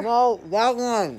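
loud, drawn-out insisting; insistent. Real, not acted. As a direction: stretch it out, stubborn. Playful squabble over which one.

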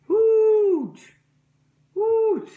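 high, loud hoot; owl hoot. A narrator's reading. The owl calls down to the bunny, loud high hoot. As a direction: call it out like a big owl. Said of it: "a large owl".

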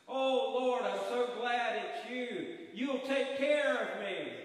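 high loud slow grateful pleading; eager pleading. Acted. Dangling man gushes gratefully to the Lord. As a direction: gush with relief to the Lord.

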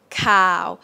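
"cow" held flat and monotone; mid tone. Shown as a demonstration. Teacher models the Thai midtone, flat "cow". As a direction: say "cow" level and long, no up and down. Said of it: "Midtone is flat".

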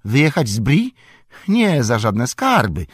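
high, loud, horrified refusal; frankly frightened. A narrator's reading. Innkeeper asked to leave Bree, frightened. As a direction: balk in alarm, voice leaping up. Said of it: "szczerze wystraszony".

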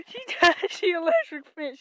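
high, loud, cracking up; gleeful, helpless outburst; laughing shout. Real, not acted. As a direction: holler it through laughter. Laughing as animal hits electric fence.

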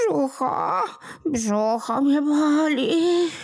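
very high, tearful whine of a tiny creature; a sore little imp wailing for pity; whimpering little goblin. Acted. Little kłobuk crying to old Smętek, very high and tearful.

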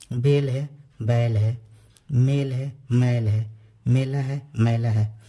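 "बेल" with short e, "बैल" with open ai, each pair set apart; vowel contrast. Shown as a demonstration. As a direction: contrast "मेल" e against "मैल" ai clearly. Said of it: "ये ए की मात्रा है, ये ऐ की मात्रा है". ("ए" और "ऐ" की मात्रा का फ़र्क़ साफ़ बोलना)

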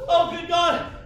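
normal talking voice

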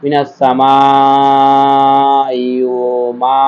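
very slow chanted verse; Quran recitation. Shown as a demonstration. Teacher reciting the verse for students, slowly chanted. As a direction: draw every vowel out, reciting. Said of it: "মিম জের মি".

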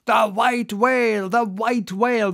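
the whole crew calling out the sighting together; eager cry. A narrator's reading. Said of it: "was the cry from captain".

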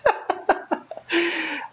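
high amused laugh; laughing at the punchline. Real, not acted. Preacher laughing at his joke before the congregation. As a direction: laugh at your own joke.

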